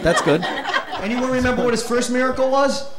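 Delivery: ordinary talk, nothing marked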